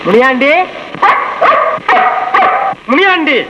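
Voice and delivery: high-pitched call to someone indoors; hollering at the door for someone to come out. Acted. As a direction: call the name out, waiting between calls. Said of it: "ஏன் இப்படி கத்துற?".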